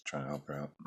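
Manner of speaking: usual speaking voice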